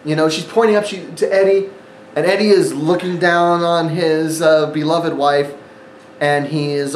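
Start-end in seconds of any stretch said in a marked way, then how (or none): none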